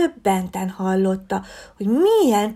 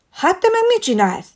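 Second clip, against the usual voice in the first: indignant bear cub; 10 semitones higher, 5 dB louder than usual. A narrator's reading. Bear catches fox breaking swing.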